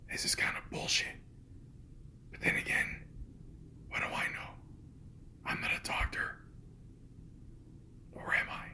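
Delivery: ordinary delivery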